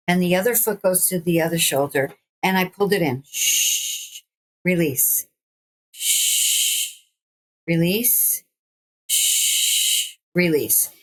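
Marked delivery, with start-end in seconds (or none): 3.3-4.2 long hissed breath out; demonstrated exhale. Shown as a demonstration. Demonstrates breathing out during stretch. 5.9-7.0 long hissed breath out; demonstrated exhale. Shown as a demonstration. Demonstrates breathing out during stretch. 9.1-10.2 long hissed breath out; demonstrated exhale. Shown as a demonstration. Demonstrates breathing out during stretch.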